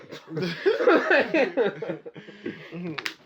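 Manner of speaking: loud, long burst of laughter. Real, not acted. Friend bursts into long laughter at the joke. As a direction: crack up loudly.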